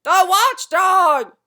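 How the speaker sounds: very high, very loud, slow shriek of terror; terrified shout. A narrator's reading. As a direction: scream it out in panic. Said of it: "shouted another".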